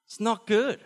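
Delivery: loud, emphatic verdict. Acted. Preacher declares goat bad, loud and emphatic. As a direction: stamp it down loudly.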